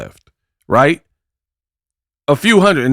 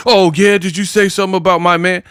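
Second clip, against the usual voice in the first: mocking imitation; 7 semitones higher, faster than usual. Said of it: "she has to repeat what they said".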